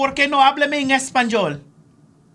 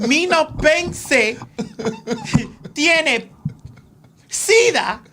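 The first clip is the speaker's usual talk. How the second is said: laughing; 5 semitones higher, slower than usual. Retold or imitated. Retelling her line while laughing.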